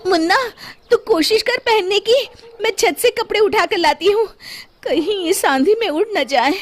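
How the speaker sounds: high, quick mother calling out; a mother rushing off; mother's voice. A narrator's reading. Mother calls to son. (माँ की ऊँची जल्दबाज़ आवाज़)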